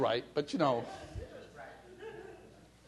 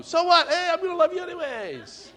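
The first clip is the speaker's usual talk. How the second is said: breezy defiant reply; 11 semitones higher than usual. Pretend. Imagined reply to hater.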